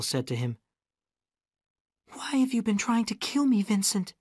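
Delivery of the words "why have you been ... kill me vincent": high-pitched woman's voice, pleading question; woman confronting killer. A narrator's reading. Gale asks gangster why he wants her killed, high. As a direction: ask it in a high, intense voice.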